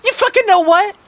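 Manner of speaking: very high, loud outburst; acted angry squeal; fed up. A narrator's reading. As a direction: shriek the question at him. Martha explodes at T D.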